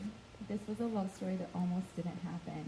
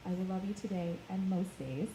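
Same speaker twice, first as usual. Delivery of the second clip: ordinary delivery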